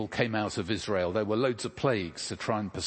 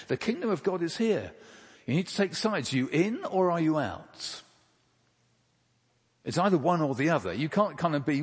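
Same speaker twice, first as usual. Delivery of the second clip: higher, urgent preaching push; fiery preaching; 6 semitones higher than usual. Acted. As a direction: press the congregation to choose, full force. Urgent appeal to congregation.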